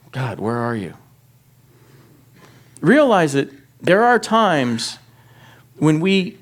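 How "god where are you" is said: soft lost plea. Acted. Believer feeling abandoned.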